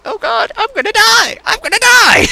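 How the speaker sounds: high, loud mock terror in the passenger seat; panicked scream. Scared passenger in sister's car, high panicked shriek. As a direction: shriek like you're about to crash. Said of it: "I'm just like".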